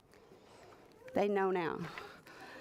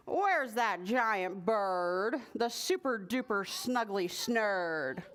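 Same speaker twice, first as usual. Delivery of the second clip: slow drawn-out question; 2 semitones lower than usual. Acted. Lynn asks impatiently.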